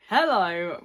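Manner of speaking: bright loud hello; loud greeting. Acted. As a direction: call out the hello. Loud greeting after quiet one.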